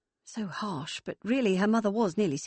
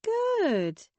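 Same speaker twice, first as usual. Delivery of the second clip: circumspect; 3 dB louder than usual. Acted. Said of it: "she said circumspectly".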